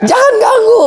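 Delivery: very high-pitched mock yell through laughter; tiruan mengusir. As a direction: imitate panicked shooing, about to crack up. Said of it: "kata kita kan". (tiruan teriak mengusir sambil menahan tawa)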